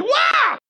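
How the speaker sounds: sudden piercing high shriek; mock scream. Witch shrieking at the ghost rising, sharp and high. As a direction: let out the witch's shriek of terror. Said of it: "She screams".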